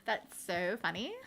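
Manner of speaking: mock Valley Girl with rising end; lightly put-on Valley Girl accent. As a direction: fake Valley Girl uptalk. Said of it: "very offensive Valley Girl faux accent".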